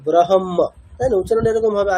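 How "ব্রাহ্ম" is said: ব্রাহ্ম said as brahom, h before m; spelling-order reading. Shown as a demonstration. Teacher demonstrating the spelling-order reading of ব্রাহ্ম, h before m. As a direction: sound out ব্রাহ্ম with the h first, then m. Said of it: "যেমন spelling তেমন উচ্চারণ হবে".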